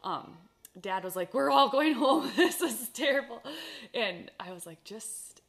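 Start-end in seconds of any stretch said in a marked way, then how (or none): 1.3-3.4 louder, laughing half-shout of a fed-up dad; retold dad, loud and laughing; mock exasperated dad. Dad's exasperated reply, retold. 4.8-5.4 put-on soft, hushed, soothing to a scared kid; quiet retold comfort; calm reassurance. Calming scared child on horse.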